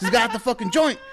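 high, loud lookalike hustler. Retold or imitated. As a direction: imitate a rapper soundalike, loud and high. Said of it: "And perfect impression".

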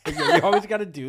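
ordinary talk

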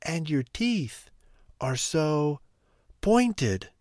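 put-on high, halting little-girl fright; alarmed, broken by pauses; gasping. A narrator's reading. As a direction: gasp it out in pieces, pitch climbing.

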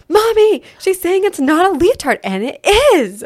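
high, loud indignant tattling to mom; mad little kid. Retold or imitated. Mad kid tattles to mom about the leotard argument, high indignant protest. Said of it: "then Navy got mad".

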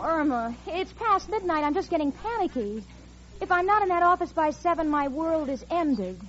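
normal talking voice

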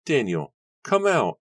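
high, loud call into the fire; desperate cry. Acted. Nora calls to Daniel trapped inside, high desperate cry. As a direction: cry out urgently, pitch leaping way up. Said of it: "Nora cried".

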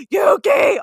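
loud shouted name; shouting. Retold or imitated. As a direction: yell it in all caps. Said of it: "all caps".

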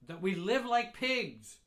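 high, loud outraged rant; angry shout. Retold or imitated. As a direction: shout the complaints in a rage. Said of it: "She shouts".

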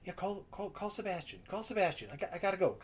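lightly voiced man rushing off the phone; hurried phone call. [name] on the boat, taking a call.

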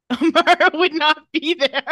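high, loud burst through laughter; laughing. Real, not acted. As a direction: crack up while saying it. Laughing over the punchline.

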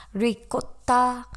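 drawn-out Italian "Ricotta"; Italian-style pronunciation. Shown as a demonstration. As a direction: savor "Ricotta" syllable by syllable in an Italian accent. Repeats word in Italian-style pronunciation.

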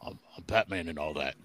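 very low mock Batman. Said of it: "I still wanna go back to".

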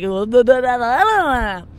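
loud, drawn-out "ooh, da-da-da" babble; mimicked loud nonsense babble; mock reaction noise. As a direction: babble loudly, stretching it out. Acts out own reaction to baristas.

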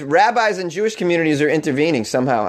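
normal talking voice

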